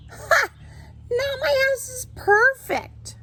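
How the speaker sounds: high, delighted cheer, slowed on the last words. Acted. Hermit Crab admiring his finished shell, gleeful cheer. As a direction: crow with joy at the finished house. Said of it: "cheered the Hermit Crab".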